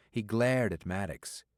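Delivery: usual speaking voice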